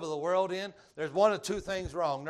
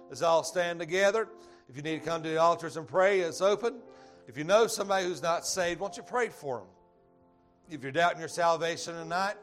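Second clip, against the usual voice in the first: louder inviting call over music; altar call; 4 dB louder than usual. Acted. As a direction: urge them forward to the altar.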